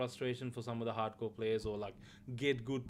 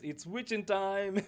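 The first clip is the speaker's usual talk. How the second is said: mock hero catchphrase; 6 semitones higher, slower than usual. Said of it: "when he says".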